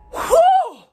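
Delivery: high loud blowing out; loud hot exhale. Real, not acted. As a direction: blast the air out. Mouth burning from hot chip.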